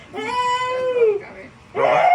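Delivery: high, excited call to the dog; thrilled greeting for the new puppy; excited squeal. Real, not acted.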